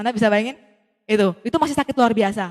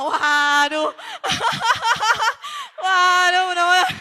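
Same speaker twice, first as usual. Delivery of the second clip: laughing groan; 6 semitones higher, much slower than usual. Real, not acted. Telling the audience about the price, laughing in dismay. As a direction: laugh through the dismay, breaking into a cry. Shocked at the price she's told.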